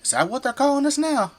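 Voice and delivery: a laughing, incredulous question; amused surprise. Acted. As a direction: pitch jumps up in amused disbelief. Surprised at being called Michigander.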